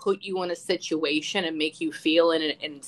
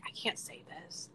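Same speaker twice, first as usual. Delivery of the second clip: whisper; 19 dB quieter than usual. Retold or imitated. Panicked aside during a reading.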